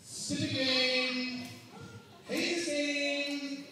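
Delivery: mock childish grumble; grumbling child. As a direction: drag it out like a kid muttering at a toy. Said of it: "And then she said".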